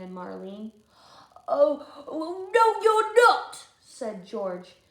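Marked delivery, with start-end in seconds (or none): 1.5-3.7 loud, high, slow "no"; a squeaky boy blocking the way; bossy refusal. Acted. George refusing the twins, loud and high.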